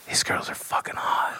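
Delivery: unvoiced conspiratorial whisper. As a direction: whisper it like a secret. Guy whispers to him.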